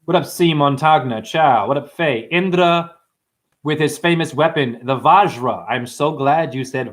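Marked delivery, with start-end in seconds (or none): none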